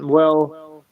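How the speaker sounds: ordinary talk, nothing marked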